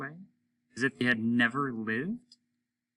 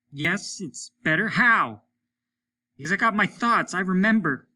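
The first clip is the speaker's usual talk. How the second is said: higher, loud indignant reply; indignant cry; 8 semitones higher, 7 dB louder than usual. A narrator's reading. Lesper answers Hollis from far away, indignant and loud. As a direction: defend your memories fiercely. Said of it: "cried Lesper, far away, indignant".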